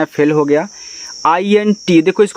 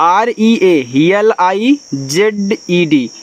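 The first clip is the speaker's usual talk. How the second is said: letter by letter, slow; slow spelling; much slower than usual. Shown as a demonstration. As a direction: spell it out for the class. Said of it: "इसको पढ़ते हैं realised".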